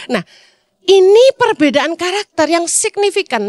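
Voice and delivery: normal talking voice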